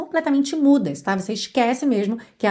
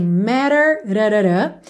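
American flapped t, "matter" as "rarará"; standard american accent; slower than usual. Shown as a demonstration. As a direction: flap the t like an American. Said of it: "Estamos com sotaque americano".

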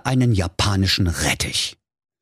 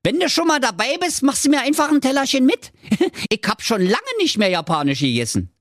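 high, fast, mocking with a laugh; cheeky web designer; faster than usual. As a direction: needle him, giggling. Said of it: "sprach da Lars Lutz Loderhose".

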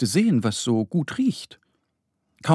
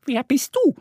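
high-pitched duck asking a stranger; little duck; 3 dB louder than usual. A narrator's reading. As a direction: ask curiously in a high duck voice. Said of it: "fragt Annie". (hohe Entenstimme, fragend)

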